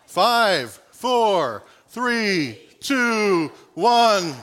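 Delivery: loud new year countdown; countdown shout. Pretend. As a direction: call out the countdown loudly. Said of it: "I'm gonna count down from five".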